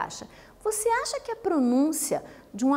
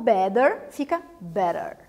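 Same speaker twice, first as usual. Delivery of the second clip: American "better" with the T tapped like a quick R; American accent; 5 semitones lower, slower than usual. Shown as a demonstration. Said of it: "o T soa como R e é mais vibrante". ("better" com sotaque americano, o T soando como R)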